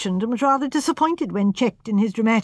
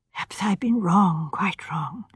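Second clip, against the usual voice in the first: whispered, falling away to almost nothing; anxious whisper; 3 semitones lower than usual. Acted. Miss Marple whispers her doubt to the visitor, anxious and hushed. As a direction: breathe the doubt out, barely voiced.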